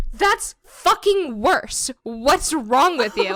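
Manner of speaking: loud, high mock outrage; exasperated retold yell, slow and emphatic; outraged scolding. Roommate appalled by her fake crying, outraged.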